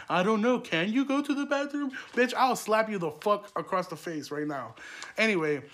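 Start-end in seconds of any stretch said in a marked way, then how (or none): 0.1-1.9 high-pitched sarcastic teacher voice; mock teacher, sing-song and high; mocking snarky teacher. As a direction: mimic a smug teacher correcting a kid, pitched way up. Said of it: "those head-ass teachers that be like".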